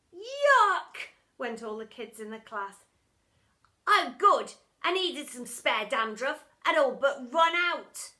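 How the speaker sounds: a hint of a delighted boy; cheeky boy. A narrator's reading. Boy happily munching the teacher's earwax.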